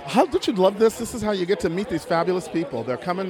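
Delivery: ordinary delivery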